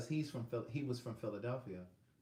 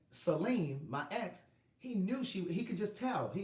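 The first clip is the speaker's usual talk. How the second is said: ordinary talk, nothing marked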